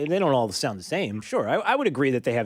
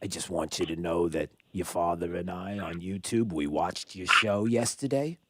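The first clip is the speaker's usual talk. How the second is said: lower, softer imitation of an upset mother; mother's voice; 8 semitones lower, 3 dB quieter than usual. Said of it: "She said".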